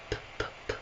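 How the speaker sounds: whispered "P, p, p" with only air; voiceless P. Shown as a demonstration. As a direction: breathe out "P, p, p" with no voice. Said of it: "p is voiceless".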